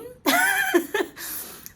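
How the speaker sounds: high-pitched giggle bursting out; high laugh. Real, not acted. Baker judging her cream cheese frosting, high giggle. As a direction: giggle at your own lukewarm verdict.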